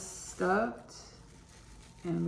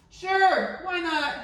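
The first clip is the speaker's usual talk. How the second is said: bright, much higher and louder sure; 11 semitones higher, 7 dB louder, much slower than usual. Shown as a demonstration. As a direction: shout it up high and eager.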